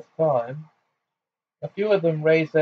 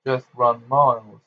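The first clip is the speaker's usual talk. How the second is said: ordinary delivery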